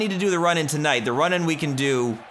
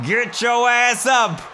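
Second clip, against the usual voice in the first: shouting at a downed wrestler to get up; louder urging shout; 9 semitones higher, 4 dB louder, slower than usual. Real, not acted. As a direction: yell it up at the screen, higher.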